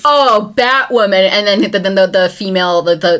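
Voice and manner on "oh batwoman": mock excited greeting. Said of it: "he's like".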